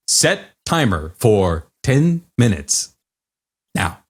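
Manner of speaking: slow flat robotic voice. Shown as a demonstration. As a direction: talk like a voice assistant robot. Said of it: "you gotta do the robotic voice".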